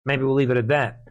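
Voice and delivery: usual speaking voice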